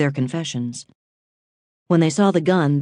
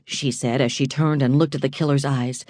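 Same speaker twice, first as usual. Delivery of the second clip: plain reading voice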